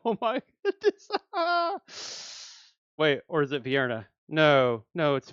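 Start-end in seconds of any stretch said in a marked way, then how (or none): 0.0-3.0 higher, laughing through the words; giddy laughter. Real, not acted. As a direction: laugh it out with delight. Still thrilled by the pull.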